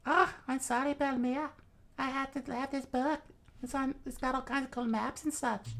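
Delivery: put-on very high, squeaky child voice; put-on small girl pleading her case; apologetic little girl. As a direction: explain yourself in a tiny kid voice. Child Nami apologizes to Belmere.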